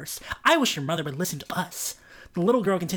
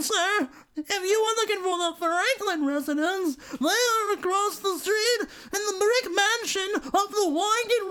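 grandma voice; 12 semitones higher than usual. A narrator's reading. Grandma directs them away.

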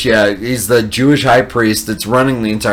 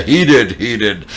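dropped-down, hasty self-correction; women posing as men. Retold or imitated. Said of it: "pretending to be men".

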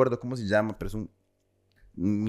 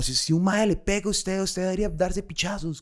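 retold yelling; 7 semitones higher than usual. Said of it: "el mae empieza a gritar como".

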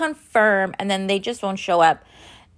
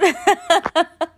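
short laugh; 8 semitones higher than usual. Real, not acted. Laughs at own joke.

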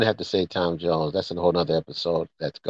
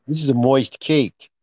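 gangster voice; 12 semitones higher than usual. Retold or imitated. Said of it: "sounds like, uh, one of those gangsters".

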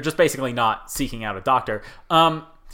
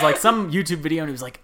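ordinary delivery

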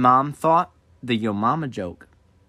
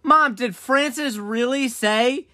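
high, slow tattletale friend voice; mock innocent snitching to mom, pitched up; tattling friend; 8 semitones higher, slower than usual.

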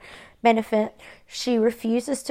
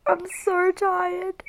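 slow, tearful, high and strained; real crying; 8 semitones higher, much slower than usual. Real, not acted. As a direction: drag it out through tears. Crying about her mother.

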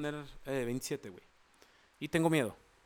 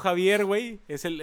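ordinary talk

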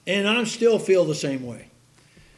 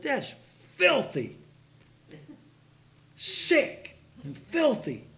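retold disgust; 7 semitones higher, slower than usual. Retold insult to passing men.

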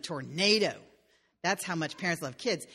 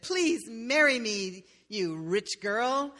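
slow proposal; 3 semitones higher, slower than usual. Acted. King proposes, drawn out.

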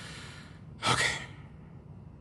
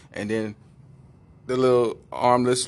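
ordinary talk

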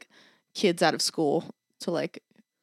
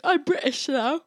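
mock British accent; 7 semitones higher than usual. Joking to friend about suddenly turning British, mock British accent. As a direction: badly fake a British accent. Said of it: "imagine if I just showed up".

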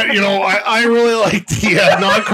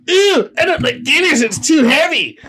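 mock complainer; 8 semitones higher than usual. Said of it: "who's like".

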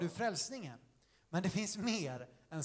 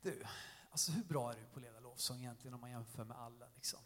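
much softer, low murmur in the ear; insinuating whisper; 9 semitones lower, 11 dB quieter than usual. Acted. Devil sows doubt in Dan's ear, whispering. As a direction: drop right down and plant the doubt. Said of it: "börjar han viska i hans öron".

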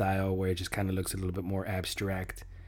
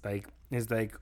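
ordinary talk, nothing marked

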